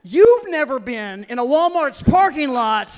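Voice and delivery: ordinary talk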